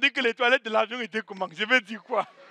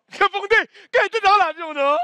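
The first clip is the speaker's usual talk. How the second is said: loud; 6 semitones higher, 5 dB louder than usual. Real, not acted. Demanding audience answer loudly.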